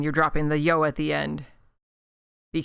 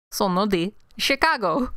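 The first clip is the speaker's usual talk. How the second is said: Chicago Italian accent; 2 semitones higher than usual. Shown as a demonstration. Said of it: "it's a Chicago Italian accent".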